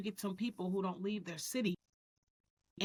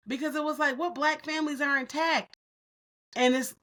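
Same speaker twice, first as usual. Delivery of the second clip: ordinary talk, nothing marked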